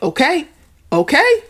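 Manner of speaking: high, loud, rising "okay, okay"; mother reacting sharply to a rude clerk, high and loud; mom's exasperated okay. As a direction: mom barely holding her tongue. Mom reacting to clerk's attitude.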